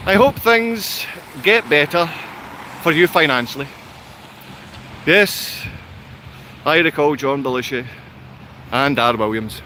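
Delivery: low-pitched, level comment reading; reading aloud. Real, not acted. Streamer reads out a viewer's message on the Scottish accent, reading aloud. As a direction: read it out low and monotone.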